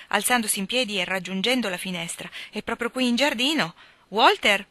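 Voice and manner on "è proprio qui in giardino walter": raised, higher call toward the garden. Acted. Esther calls her brother from the window, raised call.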